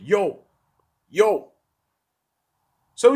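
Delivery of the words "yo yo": ordinary delivery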